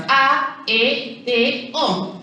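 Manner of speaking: "A, E, T, O" as four separate beats; each syllable of "A, E, T, O" said on its own. Shown as a demonstration. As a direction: count out "A, E, T, O" with a small gap after each. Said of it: "Tenemos cuatro sílabas".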